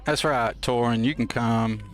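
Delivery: usual speaking voice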